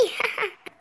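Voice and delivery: sly little laugh; gloating laugh. Acted. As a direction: laugh at your own trick. Wife gloats plan worked.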